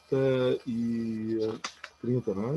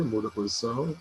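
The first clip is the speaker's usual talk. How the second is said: usual speaking voice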